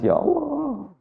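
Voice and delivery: soft, high-pitched mock wail; acted tearful lament; imitated crying. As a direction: let the last word rise into a pretend sob. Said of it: "Akhirnya nenek ini nangis".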